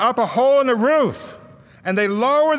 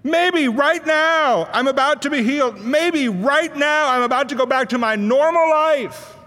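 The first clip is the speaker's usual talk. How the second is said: higher voice climbing with hope, then settling; rising excitement; 6 semitones higher than usual. Retold or imitated. Lame man before Jesus, thinking he'll walk: hopeful excitement. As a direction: lame man sure his healing has come. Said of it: "your excitement has been building up to a crescendo".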